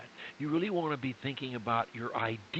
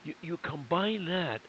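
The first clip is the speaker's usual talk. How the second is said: ordinary delivery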